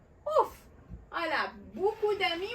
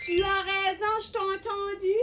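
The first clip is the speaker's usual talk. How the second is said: excited praise; 6 semitones higher, 5 dB louder than usual. Pretend. Praising a child's answer.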